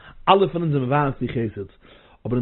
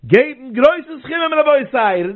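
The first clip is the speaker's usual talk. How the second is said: fiery preaching; 10 semitones higher than usual. Acted. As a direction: preach it out, urging them to give big. Fundraising appeal at full force.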